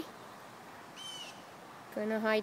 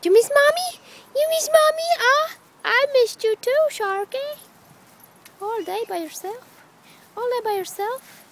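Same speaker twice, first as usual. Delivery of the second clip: very high, loud doting coo to the dog; high sing-song greeting to a missed pet; 13 semitones higher, 9 dB louder than usual. Real, not acted. Greeting her dog Sharky.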